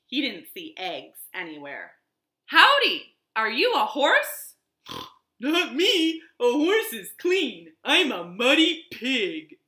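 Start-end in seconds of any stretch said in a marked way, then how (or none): 2.5-4.6 high, loud friendly howdy; cheerful cowboy greeting. Acted. Cowboy greeting a wagon, high and loud. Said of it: "said the pig". 5.4-9.6 louder, gruff pig voice; snorty pig. Acted. Pig boasting to Cowboy, gruff and loud. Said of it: "said the pig".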